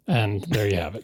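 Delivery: ordinary talk, nothing marked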